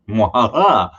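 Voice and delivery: evil cackle, mwahaha; mad scientist laugh. Pretend. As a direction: cackle like a cartoon villain. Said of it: "get your evil laugh out here".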